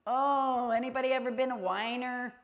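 ordinary talk, nothing marked

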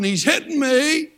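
high, slow mock cry of complaint; imitated yelling. Woman accusing the missionary who grabbed her stick, mock yell. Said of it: "she started yelling".

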